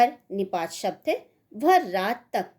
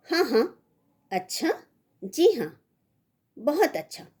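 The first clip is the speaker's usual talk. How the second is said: agreeing; 6 semitones higher than usual. Shown as a demonstration. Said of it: "स्वीकृति बोधक".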